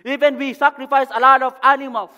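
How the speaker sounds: high, loud, impassioned pulpit delivery; full-force preaching. Real, not acted. Preacher telling the congregation why Jesus came down, preaching at full force. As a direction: drive each phrase out loudly to the congregation.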